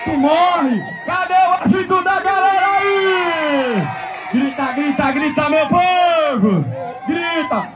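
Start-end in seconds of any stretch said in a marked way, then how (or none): 1.0-3.8 higher, slow call to the crowd; rousing the crowd. Real, not acted. 4.3-7.7 slow, called-out urging; urging the crowd. Real, not acted. Announcer urging the crowd to shout.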